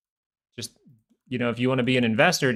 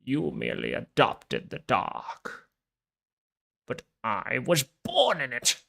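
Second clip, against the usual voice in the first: Bane; 4 dB quieter, slower than usual. Acted. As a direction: draw it out like a theatrical masked villain. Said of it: "I love Bane impressions".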